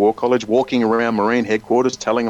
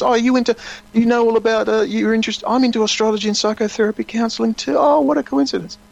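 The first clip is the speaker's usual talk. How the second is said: much higher mock gushing woman; fake enthusiasm; 9 semitones higher than usual. Woman across the bar fakes shared interests, mock gushing. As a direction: coo over the coincidence, overdone. Attractive woman feigns coincidence.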